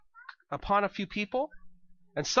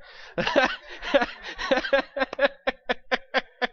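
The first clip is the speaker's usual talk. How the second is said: high, loud burst of laughter; 6 semitones higher, 4 dB louder than usual. Real, not acted. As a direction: laugh at your own silly accent. Laughing at own bad accent.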